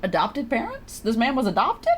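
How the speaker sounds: ordinary talk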